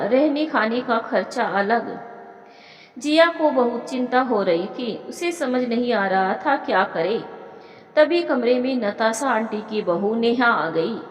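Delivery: ordinary delivery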